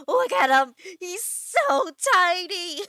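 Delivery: loud, rising coo, drawn out; real loud cuteness overload; delighted gushing. Real, not acted. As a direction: gush over something tiny, slowing on it. Gushing over tiny frog.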